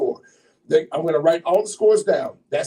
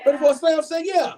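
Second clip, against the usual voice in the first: rallying shout; 9 semitones higher than usual. Real, not acted. MC pushing the slam audience to shout back, rallying shout. MC calls crowd again.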